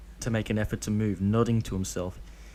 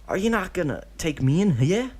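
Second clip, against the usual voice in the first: put-on high, louder boyish plea; a small boy begging, voice pitched up; child voice; 8 semitones higher, 6 dB louder than usual. Deidara asking Obito to take him in, high pleading child voice.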